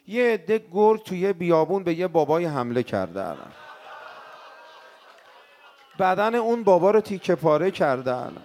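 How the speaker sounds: loud, impassioned mourning preacher; full-voiced elegy for the martyrs; preaching at full force. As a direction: pour it out to the crowd, loud.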